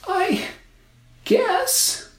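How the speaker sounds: put-on very high girl voice; hesitant little girl. A narrator's reading. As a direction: hesitate like a child.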